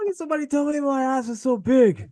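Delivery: very high squeaky whine; shrill cartoonish whine; Spaceballs impression.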